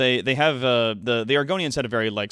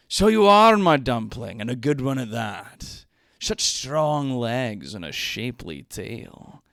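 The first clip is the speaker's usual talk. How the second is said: lusty lord voice; 6 dB louder than usual. Acted. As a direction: purr it like a sleazy nobleman. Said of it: "I'm playing both the characters".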